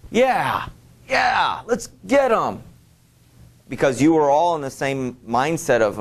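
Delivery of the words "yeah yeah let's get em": high, eager cry of a fired-up Brit; eager rallying cheer. Retold or imitated. A British listener fired up by Churchill, cheering high. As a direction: pump the crowd up like a keen citizen. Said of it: "be like".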